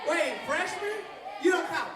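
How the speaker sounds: loud mock dismissal of the freshmen; teasing shout. Real, not acted. Pastor loudly joking that freshmen don't count. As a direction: roast them playfully.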